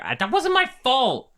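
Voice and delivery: very high-pitched defensive cry; overplayed wounded denial; whiny protest. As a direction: squeal your innocence as you walk off. Blamed character protests.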